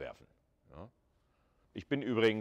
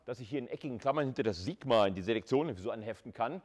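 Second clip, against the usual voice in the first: usual speaking voice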